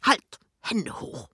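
sudden command, then hushed; calling out. A narrator's reading. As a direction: challenge like a playful hold-up. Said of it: "eine Stimme ruft".

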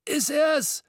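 put-on very high, croaky old man's voice; a thin old Scrooge croaking in disbelief. A narrator's reading. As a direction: squint and croak the question. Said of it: "krächzte er".

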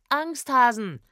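jeering shout. A narrator's reading. Trombonist steps from the bush and yells after runaways, jeering shout. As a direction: call it after them mockingly. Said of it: "schrie er".

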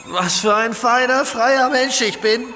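loud, elated and high; euphoric. Acted. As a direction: revel in the high. Euphorischer Rausch. (euphorisch und laut)

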